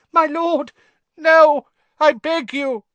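very high, loud, panicked begging; terrified pleading. A narrator's reading. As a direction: beg a cruel master for mercy. Servant pleads against punishment.

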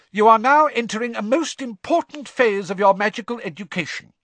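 high, loud stern professor; a strict teacher's warning; McGonagall. Acted. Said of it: "eyes glinting dangerously".

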